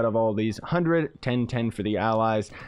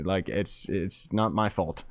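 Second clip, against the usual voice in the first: normal talking voice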